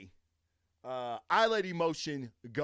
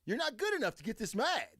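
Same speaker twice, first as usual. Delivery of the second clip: mock exasperated inner voice; 9 semitones higher, faster than usual. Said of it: "And I'm thinking".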